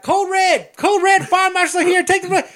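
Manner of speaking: high, urgent walkie-talkie shout; loud as fuck. Retold or imitated. Principal yelling alarm on walkie-talkie, loud as fuck. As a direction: yell the alarm at full tilt, imitating the principal. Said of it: "loud as fuck".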